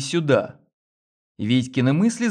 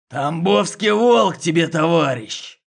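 growling; 4 semitones higher, 3 dB louder than usual. A narrator's reading. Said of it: "прорычал один из оперов".

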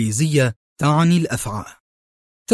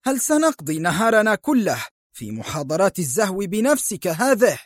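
higher, sharp-edged cutting in; sharp interruption; 7 semitones higher than usual. Acted. Michael interrupting Bernard's boasting, sharply. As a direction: cut him off, impatient. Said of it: "قاطعه مايكل في حدة".

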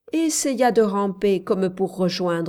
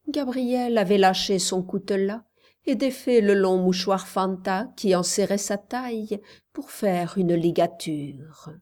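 plain reading voice

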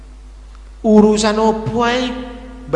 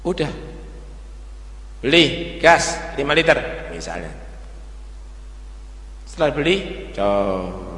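ordinary talk, nothing marked

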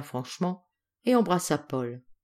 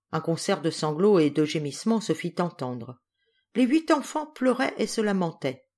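ordinary delivery

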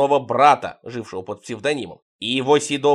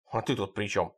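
usual speaking voice

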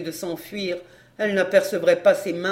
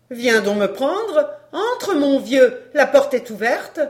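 slow, high, loud invitation; taunting woman; 4 semitones higher, 6 dB louder than usual. A narrator's reading. Woman daring the man to come in, taunting. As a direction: dare him, drawing it out.

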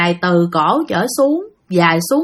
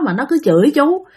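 plain reading voice

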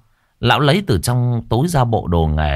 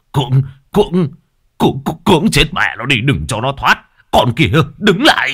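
raised, fast chasing yell; fierce chase shout; 6 semitones higher, 4 dB louder than usual. A narrator's reading. Old men chasing the fake girl, fierce raised yell. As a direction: bark at the fleeing girl. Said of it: "hùng hổ đuổi theo".

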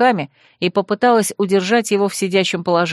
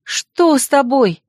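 slow, higher, anxious question; frightened; 5 semitones higher, slower than usual. Acted. Girl asking the collapsed boy what is wrong, slow frightened question. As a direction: ask in dread, slowly.

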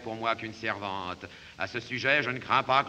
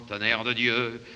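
usual speaking voice

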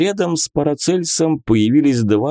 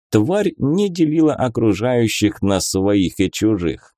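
usual speaking voice

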